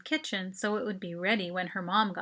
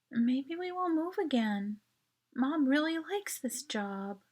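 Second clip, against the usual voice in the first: wistful; 5 semitones higher than usual. A narrator's reading. Mel musing in the dark about moving again, wistful. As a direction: let it drift off dreamily. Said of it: "Her voice turned wistful".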